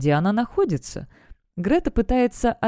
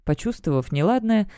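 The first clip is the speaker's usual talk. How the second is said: ordinary delivery, nothing marked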